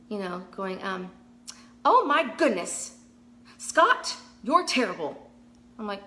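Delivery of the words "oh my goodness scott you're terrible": loud, high, slow hammed-up gasp of an overacting actress. Mocking actors who overplay a line, hammed-up high loud gasp. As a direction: ham it up like a bad soap actress. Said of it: "it drives me crazy when I see actors".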